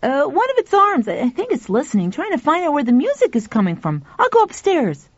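put-on high, eager boy's voice; excited boy. A narrator's reading.

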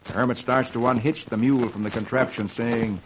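usual speaking voice

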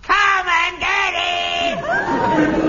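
slow, high, ringing shout held long; full-voiced drawn-out call; resonant shout. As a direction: bellow it so the hall rings. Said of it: "Such resonance".

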